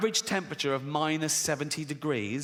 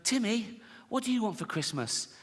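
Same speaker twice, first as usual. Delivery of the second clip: lightly acted dad, pitched up for a little child; dad to small son; 6 semitones higher, 3 dB quieter than usual. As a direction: ask your youngest a soft, lilting question. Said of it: "you go to little Timmy".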